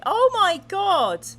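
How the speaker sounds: slow, loud, high gasp of shock. Real, not acted. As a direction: react in real horror. Shocked reaction to story.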